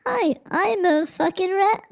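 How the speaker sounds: high squeaky rat voice. Pretend. Introducing himself to chat as a rat, in a high squeak. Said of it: "can I make it so I'm talking".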